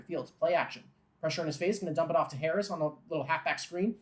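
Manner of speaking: building play-by-play call. Acted. Fan calling Harris rumbling downfield, building to an excited shout. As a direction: call the long run, swelling with excitement.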